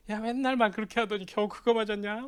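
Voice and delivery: high-pitched playful gloating; needling taunt. Retold or imitated. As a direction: rib a friend over a poor score. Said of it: "내가 걔를 또 갈궜지".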